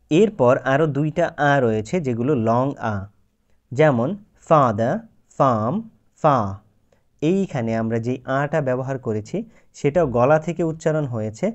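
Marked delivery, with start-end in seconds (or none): 4.5-6.5 father, farm, far slowly with a long ah. Shown as a demonstration. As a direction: draw out the ah. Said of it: "একটু লম্বা উচ্চারণ করেছি বা long করেছি".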